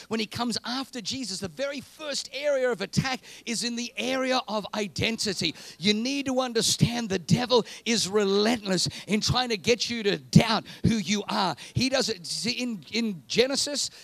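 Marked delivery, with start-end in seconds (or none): 5.8-13.9 from firm to loud shouted preaching; building sermon. Acted. Preacher pounding the congregation with questions, rising to a shout. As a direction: hammer each question harder and louder.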